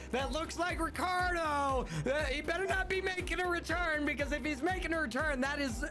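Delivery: high, fast panicked yelling. Real, not acted. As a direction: freak out at the screen. Panics seeing boss.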